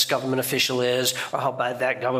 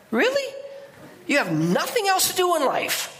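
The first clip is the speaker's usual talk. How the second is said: much higher, exasperated disbelief; incredulous scoff; 12 semitones higher than usual. Real, not acted. Preacher scoffing at the officer angry about Apollo, incredulous. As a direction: scoff at how pointless his anger is.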